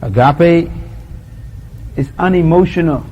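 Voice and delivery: measured preaching cadence; solemn litany. Acted. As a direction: pronounce each truth deliberately.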